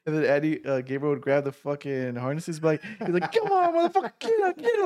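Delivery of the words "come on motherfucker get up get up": high-pitched mock giddy-up urging; imitated horse driver. Gabriel yanking the harness from the back seat, horse-driving urging. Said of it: "treat him like a horse".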